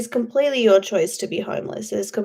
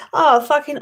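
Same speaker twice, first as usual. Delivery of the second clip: usual speaking voice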